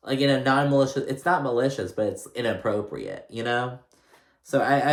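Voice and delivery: usual speaking voice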